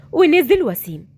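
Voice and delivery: usual speaking voice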